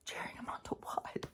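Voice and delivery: a dry whispered question. Real, not acted. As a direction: drop to a sharp whisper, puzzled. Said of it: "whispered aside".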